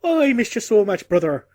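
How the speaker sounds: high, loud, jolly mock-Irish; caricature Irish accent. Hugging giant greeting his brother, exaggerated Irish accent. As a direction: lay on a hammed-up Irish lilt. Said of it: "do it with an Irish accent".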